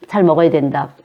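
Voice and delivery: normal talking voice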